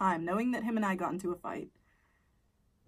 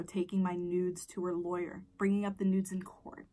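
ordinary talk, nothing marked

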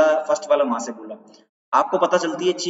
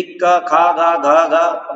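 क ख ग घ each louder than the last; getting louder; 6 dB louder, much slower than usual. Shown as a demonstration. Teacher shows multiple frequencies by raising his voice, growing louder. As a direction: build each syllable up in volume. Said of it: "बार बार आवाज बढ़ाना".

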